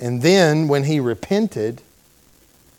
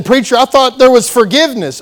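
objecting listener; 9 semitones higher, 7 dB louder, faster than usual. Retold or imitated. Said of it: "You say".

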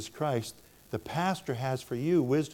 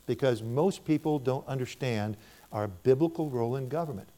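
ordinary delivery

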